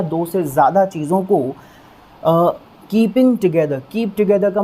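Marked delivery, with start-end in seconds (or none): none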